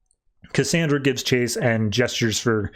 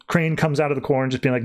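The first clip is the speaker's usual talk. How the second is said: usual speaking voice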